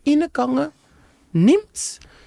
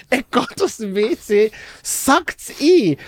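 ordinary talk, nothing marked

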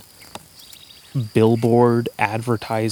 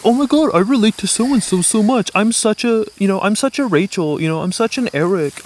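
high, louder gushing fangirl; gushing fan voice; 10 semitones higher, 5 dB louder than usual. Retold or imitated. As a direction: squeal it like an excited superfan. Mocking gushing TV fans.

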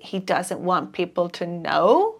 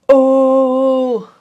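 shocked; 3 semitones higher, 9 dB louder than usual. Acted. Hearing the will was revoked, a loud shocked Oh. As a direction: caught off guard by the news.

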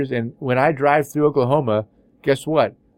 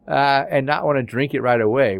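ordinary delivery

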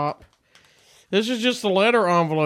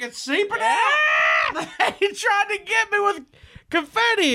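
high excited laugh-shout; 13 semitones higher, slower than usual. Real, not acted. Spots confetti leaking out.

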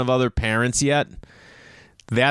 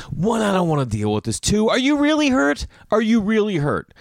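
high, exasperated acted questioning; exaggerated annoyed parent; annoyed inner voice; 10 semitones higher than usual. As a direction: play up the fed-up disbelief in a high pitch. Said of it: "I'm so annoyed. I'm like, in my head".

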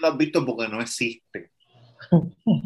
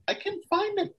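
high and soft, falsely sweet; mock innocent girlfriend; 5 dB quieter than usual. Movie girlfriend pretending she lost the keys, high and soft.

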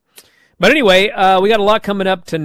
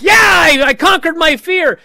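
much higher, loud triumphant yell of "conquered"; triumphant shout; 13 semitones higher, 8 dB louder than usual. Retold or imitated. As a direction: shout it in victory. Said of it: "And then she's like".

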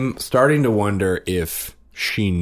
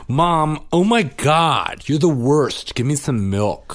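put-on toddler complaining; 10 semitones higher, 5 dB louder, slower than usual. Dad voicing toddler demanding milk.

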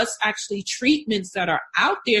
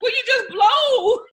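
imitating husband; 11 semitones higher than usual. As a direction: mimic a husband begging her to blow up. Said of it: "that would be my husband".

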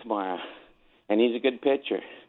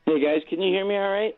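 caller on a speakerphone line; thin speakerphone sound, far from mic; 5 semitones higher, faster than usual. Real, not acted. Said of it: "'cause you're on speakerphone".

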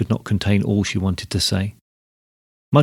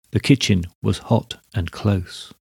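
plain reading voice

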